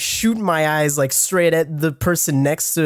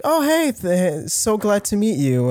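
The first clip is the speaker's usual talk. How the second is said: lightly voiced old artist, all friendly; friendly old man; 5 semitones higher than usual. Old artist greeting the audio engineer, higher voice. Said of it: "He's like".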